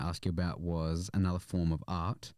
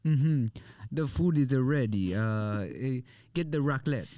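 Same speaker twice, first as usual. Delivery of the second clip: hammed-up French accent, higher and louder; mock French accent; 5 semitones higher, 5 dB louder than usual. Imitating a French cook announcing food is ready in a mock French accent. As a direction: play the pompous French chef announcing dinner. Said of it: "I always do this when I imitate French".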